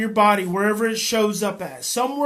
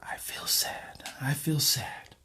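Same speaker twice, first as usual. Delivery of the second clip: slow, hushed echo; soft, low whisper; whispered repeat; 6 semitones lower, 9 dB quieter, slower than usual. Acted. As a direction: repeat it under the breath. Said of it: "you repeat it".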